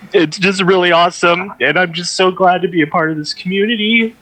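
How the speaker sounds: holding back tears. Real, not acted. Host thanking the community, choked up with tears. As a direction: push thanks out as tears rise. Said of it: "I'm trying not to cry".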